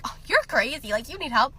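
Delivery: light, playful mock teasing; teasing, voice lifted a little; joking teasing. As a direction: rib a friend in jest. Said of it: "when people are joking".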